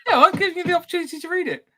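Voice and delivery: high, amused self-defence; laughing comeback. Real, not acted. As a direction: answer the teasing through laughter. Answers the teasing, laughing.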